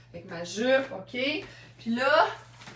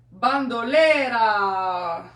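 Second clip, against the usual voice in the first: loud, drawn-out brand name; big lingering flourish; theatrical announcing; 6 dB louder, much slower than usual. Real, not acted. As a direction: stretch it out grandly and let it fall.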